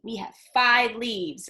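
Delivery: ordinary delivery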